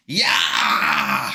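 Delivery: loud, low whoop; mock war cry. Harry jumping on the troll with a loud whoop. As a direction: yell it like charging in. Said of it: "was like".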